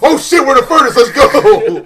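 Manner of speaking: loud, high panicked shout with a laugh; yelling in mock terror to flee. Crew realizing they're in the furnace, panicked shout.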